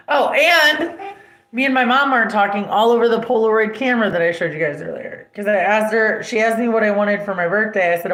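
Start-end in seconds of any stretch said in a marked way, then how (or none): none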